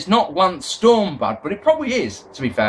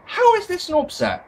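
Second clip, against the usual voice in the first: incredulous; 11 semitones higher than usual. Retold or imitated. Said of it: "you just go".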